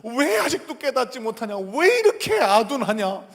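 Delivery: acted outburst of exasperated reproach; stern rebuke. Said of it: "예수님이 막 책망하시는 거예요".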